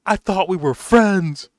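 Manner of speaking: the guard telling Arthur he feels betrayed, mock-hurt. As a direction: sound betrayed by a friend, overdone. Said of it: "like, emotion of".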